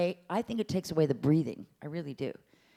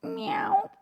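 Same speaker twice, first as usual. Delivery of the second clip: high falsetto meow; cat meow demo above the pencil; high kitty meow. Shown as a demonstration. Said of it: "sound of a kitty cat".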